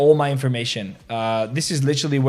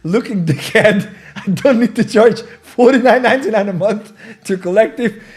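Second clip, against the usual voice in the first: laughing through quote; 5 semitones higher, 4 dB louder than usual. Retold or imitated. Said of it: "Ansem goes nuts".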